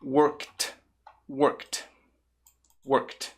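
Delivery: "worked" ending in a crisp "kt"; final T sound. Shown as a demonstration. Teacher shows learners the ending, "worked" with a T sound. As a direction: say "worked" as one syllable, "workt". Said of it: "é com som de T".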